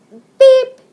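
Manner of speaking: sudden shrill-free high yelp of beep; punchline beep, high and loud. Acted. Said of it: "he says to himself".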